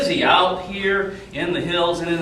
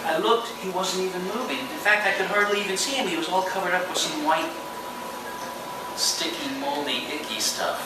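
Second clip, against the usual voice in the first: on stage. Acted. Storyteller performing for children.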